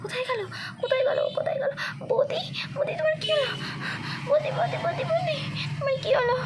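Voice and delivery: very high, tearful repeated calls; frantic. Acted. Girl calling her unresponsive sister-in-law, high frantic distress. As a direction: plead in a high, panicky wail.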